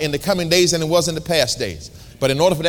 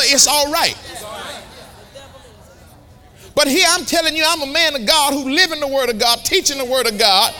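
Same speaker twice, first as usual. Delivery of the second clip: mock indignant retort; 11 semitones higher than usual. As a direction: set the stranger straight, half laughing. Preacher lectures the stranger.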